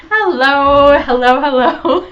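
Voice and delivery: loud, drawn-out singsong hellos; sing-song greeting. Real, not acted. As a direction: call out a big cheery hello to the chat. Host greeting live chat cheerfully.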